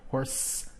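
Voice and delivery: HORSE with a long hissed S; sibilant ending. Shown as a demonstration. Teacher letting learners hear the hissing sound, hissed ending. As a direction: let the final s of "Horse" hiss softly. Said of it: "You can hear the sibilant sound".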